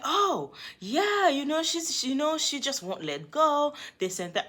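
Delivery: high, sheepish excuse voice; mock boyfriend excuse. Boyfriend brushing off the texts to her, high and sheepish. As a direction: shrug it off, pitched well up.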